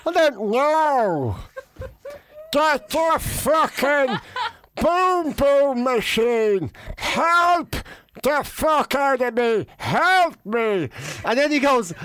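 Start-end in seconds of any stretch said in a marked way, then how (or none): 0.4-10.9 slow, agonised mock pleading; winded panic. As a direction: drag each word out as if dying on the canvas. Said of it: "I thought I was having a cardiac arrest".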